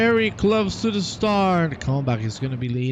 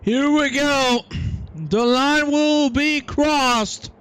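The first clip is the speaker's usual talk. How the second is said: race commentator; 10 semitones higher, slower than usual. Acted. Streamer calling the race start to viewers, excited commentator voice. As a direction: call the start like a live broadcaster.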